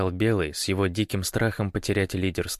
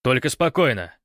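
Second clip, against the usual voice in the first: raised, louder call for calm; shouted; 5 semitones higher, 5 dB louder than usual. A narrator's reading. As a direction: call it out to hold someone back. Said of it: "крикнул Белый". (окрик, повышенным голосом)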